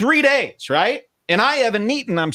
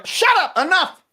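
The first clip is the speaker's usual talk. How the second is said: shrill high snap of shut up, enough; high exasperated outburst; exasperated shout; slower than usual. Retold or imitated. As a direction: cut him off sharply, fed up. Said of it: "And I go".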